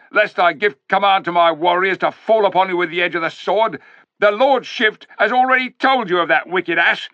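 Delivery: high, loud menacing speech; threatening warlord. A narrator's reading. Tarkan threatening the Narnians, loud and commanding. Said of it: "thundered Rishta Tarkan".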